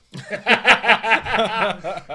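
high, loud belly laugh; burst of laughter. Real, not acted. Co-host cracking up at friend's bar story, loud high laugh. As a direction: crack up hard at the punchline.

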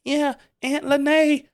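put-on high, slow child voice; mock little niece. Mocking the niece who played along, high child voice. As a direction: squeak it out like a little girl. Niece quoted in retold story.

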